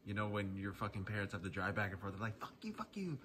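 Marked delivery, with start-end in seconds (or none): 2.4-3.2 high squeaky mocking parent voice; sarcastic parent mimicry, softer; mock grumpy parent. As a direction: whine it like an irritated parent. Parents mocked as annoyed drivers.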